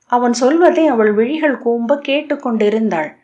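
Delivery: plain reading voice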